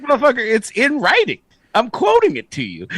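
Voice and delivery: high, exasperated mock rant; hammed-up rule quoting fury; mock indignant worker. Rule-follower snapping at the boss, high mock indignation. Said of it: "It's like".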